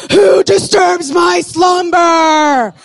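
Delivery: slow booming monster voice; cave guardian voice. Pretend. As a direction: bellow it like an ancient cave spirit. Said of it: "and somebody knocks and it goes".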